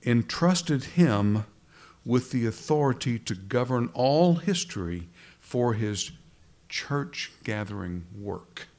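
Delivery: slow, weighty reading, each word leaned on; slow stressed emphasis. Shown as a demonstration. Preacher reading out the key quote to his congregation, slowly stressing it. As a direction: underline every word by slowing right down. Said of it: "underline this".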